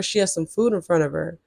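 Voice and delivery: ordinary delivery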